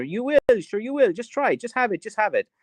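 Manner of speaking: high, pushy Irish mammy voice; insistent Irish mom. Retold or imitated. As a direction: coax like an insistent mother. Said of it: "And Irish moms are".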